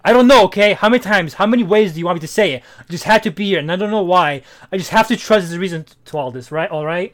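loud, exasperated quoted outburst; insistent quoting. Retold or imitated. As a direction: read it out loud like he's fed up. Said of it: "I like the way he says it".

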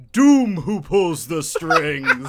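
slow, loud, drawn-out villain reveal; grandiose villain. Retold or imitated. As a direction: build it into a theatrical boast.

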